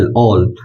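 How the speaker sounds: all said low with a rounded "aw" vowel; teacher models all, low and rounded; model pronunciation. Shown as a demonstration. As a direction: demonstrate all as "awl". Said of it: "A double L".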